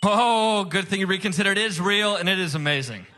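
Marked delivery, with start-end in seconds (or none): none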